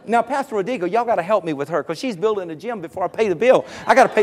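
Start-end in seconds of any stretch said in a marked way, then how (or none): none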